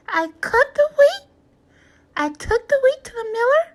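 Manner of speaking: high, loud indignant hen listing her work; scolding hen. A narrator's reading. Hen scolding the animals who wouldn't help, high and loud. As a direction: build it up, crowing about every job.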